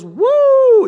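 high, loud whoop of church excitement; shouted woo. Retold or imitated. Preacher mimics a worshipper's sudden high loud whoop. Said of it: "where it's just gotten weird".

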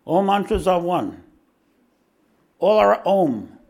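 booming guru pronouncement; guru's proclamation. Retold or imitated. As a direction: proclaim it like the old master. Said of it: "He says".

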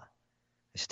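usual speaking voice

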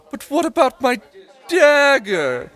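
high, loud, whining noble; whiny noble. Acted. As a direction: squeal it like a flustered noble. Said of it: "Leslie goes".

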